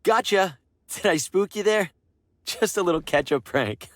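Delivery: high, loud prankster glee; spring the prank with a cackle in the voice; pleased jump-scare tease; gleeful gotcha. Acted.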